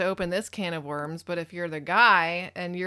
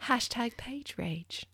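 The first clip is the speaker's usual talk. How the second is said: slow, breathy whispered hashtag; mock whisper; 5 semitones higher, 6 dB quieter, slower than usual. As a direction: whisper it like a secret joke. Jokey hashtag aside.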